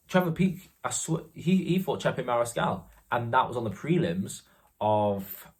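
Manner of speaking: ordinary delivery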